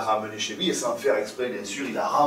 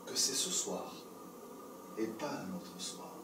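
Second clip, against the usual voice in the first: dramatic hush; 6 semitones lower, 15 dB quieter than usual. A narrator's reading. Narrator hushes at the climax.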